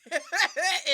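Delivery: high loud cackle; loud burst of laughter. Real, not acted. Co-host cracking up at the clip. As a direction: break out laughing.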